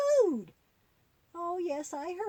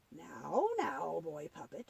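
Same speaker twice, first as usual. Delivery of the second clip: much lower, softer granny voice soothing him; calming; 9 semitones lower, 5 dB quieter, slower than usual. Acted. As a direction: settle him down gently, low. Granny puppet calming the boy.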